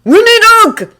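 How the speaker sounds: very high loud shout. A narrator's reading. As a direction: shout it urgently. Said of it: "Steve shouted".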